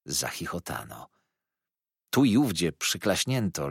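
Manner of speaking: ordinary delivery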